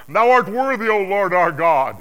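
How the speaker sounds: high, loud, ringing proclamation; slow, exalted acclamation at full volume; crying out praise. Acted. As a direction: cry it out in reverent worship, far above narration. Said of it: "they cry".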